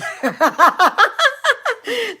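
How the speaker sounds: high, loud burst of laughter. Real, not acted. Laughing at the memory of push-ups in the snow, loud cackle. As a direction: crack up hard.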